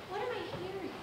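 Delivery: straining to catch a faint sound; hushed wondering. Acted. Elijah strains to hear a whisper.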